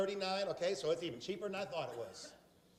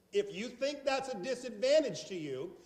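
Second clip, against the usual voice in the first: ordinary talk, nothing marked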